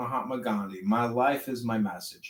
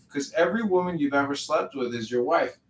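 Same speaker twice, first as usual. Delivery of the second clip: usual speaking voice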